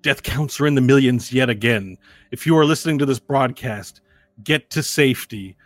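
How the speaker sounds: urgent broadcast announcer; news reporter. Acted. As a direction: read it like an emergency bulletin. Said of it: "And the news reporter says".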